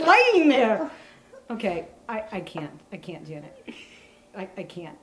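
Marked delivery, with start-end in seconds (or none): 1.5-4.9 quiet. Real, not acted. Giving up on the shot.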